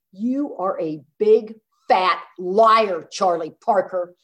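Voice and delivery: slow, loud, word-by-word accusation; re-enacted wifely outburst, drawn out; angry accusation. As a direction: land each word on him like a charge. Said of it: "I am getting angrier and angrier".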